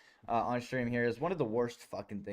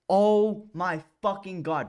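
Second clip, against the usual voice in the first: exasperated; 6 semitones higher, 6 dB louder, slower than usual. Real, not acted. Cringing at clip.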